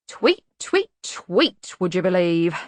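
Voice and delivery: lightly voiced cynical raven. A narrator's reading.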